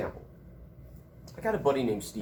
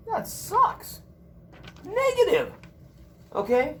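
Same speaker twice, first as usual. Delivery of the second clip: high, put-on voice of negative self-talk; mock whiny inner voice; 5 dB louder, slower than usual. Teacher mocking the negative inner voice, high and whiny. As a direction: play the sulky voice in your head.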